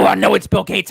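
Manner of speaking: ordinary talk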